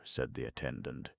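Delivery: ordinary delivery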